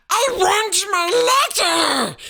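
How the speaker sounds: very high, loud shout. A narrator's reading. Boy shouting at his uncle for his letter. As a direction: yell for your letter. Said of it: "he shouted".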